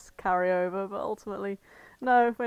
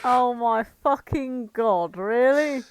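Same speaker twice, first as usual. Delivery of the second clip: loud, slow, stunned oh my god; shocked disbelief; 3 semitones higher, 6 dB louder, slower than usual. Real, not acted. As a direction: react in real shock at the news. Shocked by fanfic pairing.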